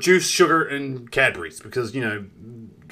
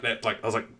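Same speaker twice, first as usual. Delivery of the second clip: ordinary talk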